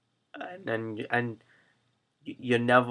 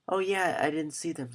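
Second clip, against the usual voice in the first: put-on higher, light child's voice; young daughter; 4 semitones higher, 4 dB quieter than usual. Daughter answering Daddy about glasses, imitated child's voice. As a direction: imitate a little girl reporting back. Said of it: "And she's like".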